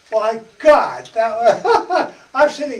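laughing through the words; can't stop laughing while picturing it. Real, not acted. Preacher laughing to his congregation about the wedding party, laughing.

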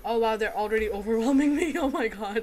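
loud, half-laughing panic; loud, flustered gamer yelp; overwhelmed outcry. Real, not acted. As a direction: cry out as the horde swarms you. Enemies swarm player mid-game.

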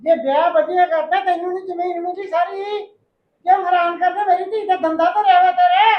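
angry retort. Acted. Daughter-in-law quarrels with mother-in-law.

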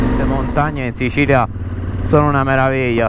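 loud over the motorbike noise; shouting over engine. Real, not acted.

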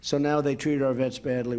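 normal talking voice

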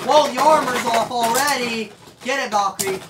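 loud, high live commentary; excited play-by-play. Real, not acted. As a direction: shout it as the action explodes. Live battle going wild.

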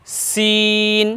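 সিন with a fine hissed s; thin seen. Shown as a demonstration. Teacher models the letter's sound, long thin সিন. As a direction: stretch the vowel of সিন on one level note. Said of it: "একটু চিকন আওয়াজ হবে".

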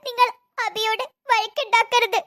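high, hammed-up soap-opera pleading; milk it like a TV serial heroine; over-acting. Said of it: "over acting".

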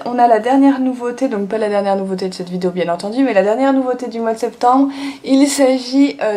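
normal talking voice

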